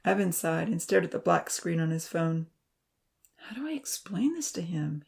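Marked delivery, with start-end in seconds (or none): 3.4-5.0 soft whispered self-question; whispering to himself. Acted. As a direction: whisper it under his breath. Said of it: "he asked himself".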